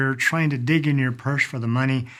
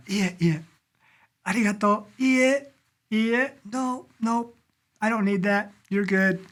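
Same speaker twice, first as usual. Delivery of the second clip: waving off thanks; 8 semitones higher than usual. Acted. Acting a Japanese waving off thanks.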